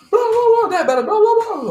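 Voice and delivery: very high, loud garbled shrieking; mock hysterical babble. Medic gleaning a word from daughter's screams, mock hysterical. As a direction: imitate someone screaming an unintelligible jumble.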